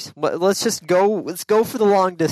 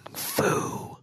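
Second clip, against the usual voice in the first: whisper; 9 dB quieter than usual. Pretend. Whispered echo of Kung Fu.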